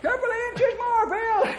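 high, loud strained calls; straining shout. Acted. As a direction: shout while straining to pull. Straining rescue from quicksand.

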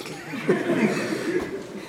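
high, wheezy giggle; amused laugh. Real, not acted. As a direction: dissolve into quiet, high laughter. Laughs at own remark.

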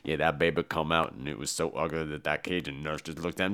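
low, soft storyteller's rumble; deep Tony Todd voice. Retold or imitated. Tony Todd's character tells the backstory, low and soft. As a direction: drop way down and spin the tale low.

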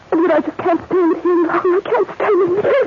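high, strained sobbing plea, slowing at the end. Acted. Jane caught on the attic stairs, sobbing to Edward. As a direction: break down in tears. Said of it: "stop sobbing".

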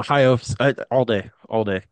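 normal talking voice

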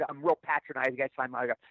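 ordinary talk

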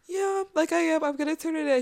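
high, small voice of her younger self; imitating younger self. Retold self answering mom.

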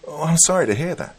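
put-on high child voice offering sympathy; a young boy's condolence, pitched well up; ten-year-old self. A narrator's reading. Boy hears the aunt passed away, high sympathetic child voice. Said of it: "It was my ten-year-old self".

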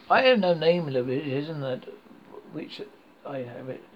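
usual speaking voice

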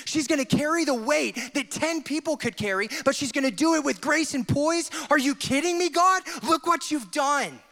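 overjoyed angelic crowd voice; ecstatic angels. Acted. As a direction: cheer like angels losing their minds. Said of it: "the angels freaking out, like".

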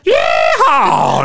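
loud cowboy whoop; yeehaw. Pretend. Mock Texan cheer.

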